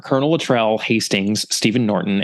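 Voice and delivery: ordinary talk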